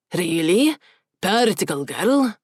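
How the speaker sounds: slow, dry deadpan. Acted. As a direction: say it bone dry. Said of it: "Gerald deadpanned".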